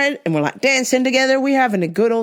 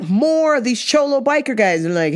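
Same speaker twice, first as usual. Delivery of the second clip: ordinary delivery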